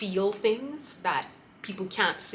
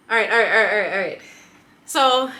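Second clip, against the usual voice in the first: loud self pep talk; 3 semitones higher, 10 dB louder, faster than usual. Real, not acted. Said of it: "I need to keep on track".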